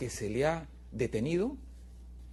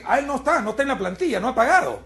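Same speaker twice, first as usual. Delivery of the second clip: loud, higher mock pollster brushing him off; 7 semitones higher, 8 dB louder, faster than usual. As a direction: play the pollster waving him away, loud and high. Acts out a pollster refusing him.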